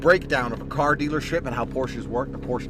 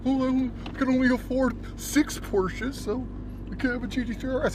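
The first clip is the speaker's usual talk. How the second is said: very high mock-dork voice; 10 semitones higher than usual. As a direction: imitate a pathetic dork, pitched way up. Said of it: "Some fucking dork".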